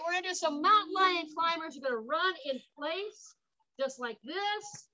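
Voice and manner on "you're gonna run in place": ordinary delivery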